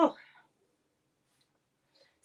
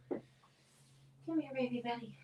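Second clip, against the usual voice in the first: softer, cooing pet voice; 3 semitones higher, 7 dB quieter, much slower than usual. Real, not acted. Coaxing her dog Benny closer, soft cooing baby talk.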